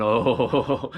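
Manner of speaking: acted belly laugh on a drawn-out "oh"; rolling oh-ho-ho laugh; giants' laughter. A narrator's reading. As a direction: chuckle it out like a pleased giant.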